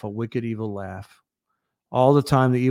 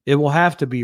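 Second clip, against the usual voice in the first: ordinary delivery, nothing marked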